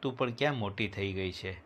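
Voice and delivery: usual speaking voice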